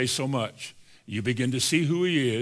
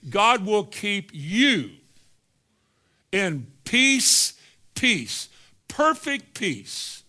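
preaching build; 6 semitones higher, 3 dB louder, much slower than usual. Acted. Preaching climax.

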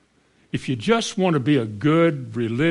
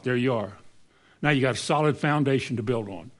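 ordinary talk, nothing marked

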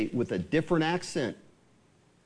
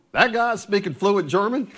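mock-astonished, higher-pitched question; higher, incredulous outburst, played for laughs; 5 semitones higher, 4 dB louder than usual. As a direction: react in amused disbelief at what you hear. Acting out astonished Ohio buddies.

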